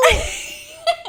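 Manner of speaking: high burst of laughter. Real, not acted. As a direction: giggle high and bright. Laughing at friend.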